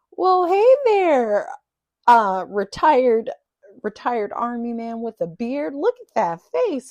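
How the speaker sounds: high, loud, drawn-out Texas greeting; Southern belle drawl. Retold or imitated. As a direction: gush like a Southern mother-in-law, stretching the vowels. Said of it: "she's got this long, drawn-out accent".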